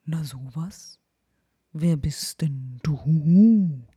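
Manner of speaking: put-on wise old owl, ending in a loud hoot. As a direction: Herr Uhu calling down from his tree hole. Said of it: "tönte Herr Uhu".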